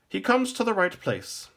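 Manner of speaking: high, loud declaration; minstrel's different voice. A narrator's reading. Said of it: "declared in a different voice".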